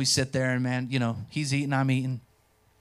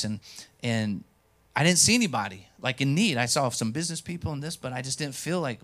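usual speaking voice

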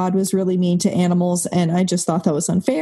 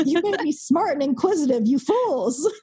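laughing gloat; 10 semitones higher than usual. Retold or imitated.

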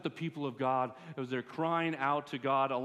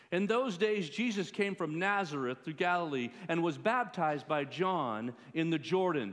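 raised, declaiming Bible reading; scripture reading; 4 semitones higher, 5 dB louder than usual. Acted. Preacher reads Jesus' baptism to the congregation, declaiming. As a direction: read the Gospel out boldly.

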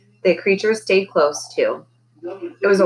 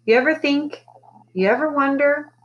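high, halting question; tentative young voice, pitched up; hesitant child; 9 semitones higher than usual. A narrator's reading. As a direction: trail upward, feeling out the words. Child hesitantly questioning her mother.

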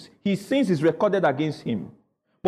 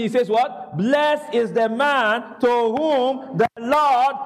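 slow, higher, drawn-out declaiming; deliberate raised-pitch preaching read; scripture read-along; 5 semitones higher, slower than usual. Real, not acted. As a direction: lead the congregation through the verse, stretching words. Leads congregational scripture reading.

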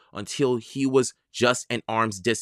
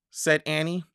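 ordinary delivery, nothing marked

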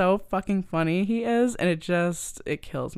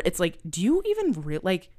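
usual speaking voice

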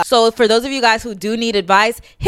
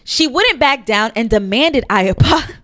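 usual speaking voice

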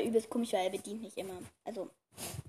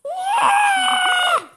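long, loud, very high squeal; high scream; 11 dB louder than usual. Real, not acted. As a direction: let out a sustained piercing shriek. Outburst after missed half hour.

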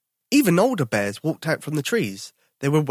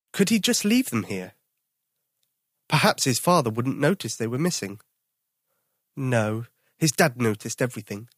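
usual speaking voice

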